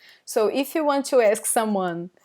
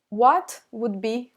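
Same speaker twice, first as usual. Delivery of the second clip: normal talking voice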